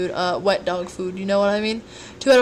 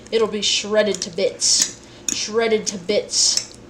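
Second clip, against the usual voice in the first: slow menacing relish; 4 dB louder, much slower than usual. Pretend. Relishing the mashing.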